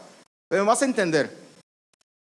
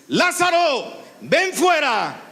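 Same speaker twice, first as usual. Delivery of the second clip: Jesus ordering Lazarus out, raised commanding call; 4 semitones higher, slower than usual. Shown as a demonstration. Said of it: "A ver, vamos a hacerlo".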